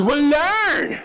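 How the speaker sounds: put-on high, curt child command; bossy little girl. Daughter ordering her dad, high and curt. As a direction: snap it like a tiny boss.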